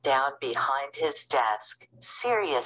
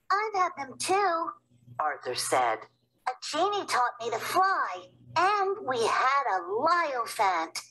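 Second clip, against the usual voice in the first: put-on high eager little boy; young boy voice; 12 semitones higher than usual. Little brother Arthur wanting to go too, high eager boy. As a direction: pipe up like a small kid.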